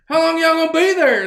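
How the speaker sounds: hammed-up boss pushing for an answer; imitating the boss. Boss asking workers at the bar, fast loud question. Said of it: "He's like".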